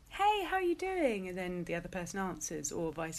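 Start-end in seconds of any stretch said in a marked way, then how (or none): 0.1-1.3 high, chirpy mock greeting; mock-cheery "hey, how are you doing". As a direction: sing out a bright hello. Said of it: "how you greet someone".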